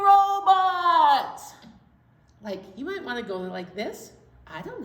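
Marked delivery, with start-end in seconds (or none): 0.0-1.5 high, loud, drawn-out call; booming announcement. Pretend. Storyteller calling out the flannel story's title, loud announcement. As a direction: sing out the title word big.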